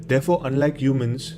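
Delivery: ordinary talk, nothing marked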